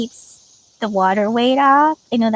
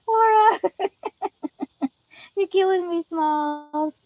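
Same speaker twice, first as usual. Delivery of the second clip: squeaky high teasing quote, trailing off laughing; Killing me Smalls movie line; 8 semitones higher, slower than usual. Pretend. Teasing a viewer who lost her coffee with a high movie quote. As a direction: toss the old movie line back with a laugh.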